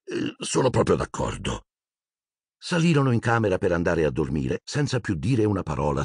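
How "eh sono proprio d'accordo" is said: sinking heart. A narrator's reading. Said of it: "con un tuffo al cuore".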